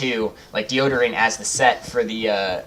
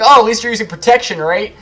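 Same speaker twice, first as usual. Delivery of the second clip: higher, louder and quicker, teasing; mock sarcastic dad; 10 semitones higher, 8 dB louder than usual. Dad mocking his son over the condom, sarcastic. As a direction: needle the kid with fake approval.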